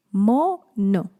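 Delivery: "Mono" split into mo, no; syllable by syllable. Shown as a demonstration. Teacher answering a syllable swap, "Mono" split mo, no. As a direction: leave a gap inside "Mono": mo... no. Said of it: "Cambien una sílaba".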